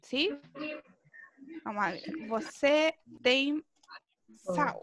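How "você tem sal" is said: "Você tem" muy lento, luego "sal"; pregunta lenta. Shown as a demonstration. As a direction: dilo despacio y claro. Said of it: "También puede ser otra forma". ("Você tem sal" pronunciado lento)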